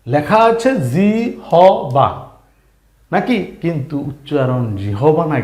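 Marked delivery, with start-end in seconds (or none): none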